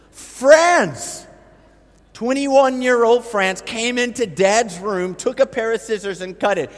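incredulous. Real, not acted. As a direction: land each word like it's absurd. Incredulous at the accused.

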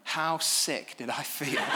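ordinary delivery

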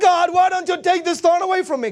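high, loud mock begging; hammed-up complaining prayer; desperate plea. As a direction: wail it up to heaven. Acts Paul's desperate plea to God.

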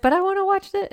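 high, childish whine, mildly hammed; whiny husband voice. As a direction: whine it like a sulky kid.

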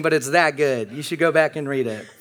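normal talking voice

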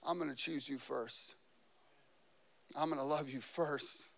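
soft, hushed and gentle; tender promise. Retold or imitated. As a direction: say it softly like a loving promise. God's tender promise retold.

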